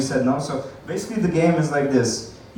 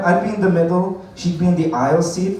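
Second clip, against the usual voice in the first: normal talking voice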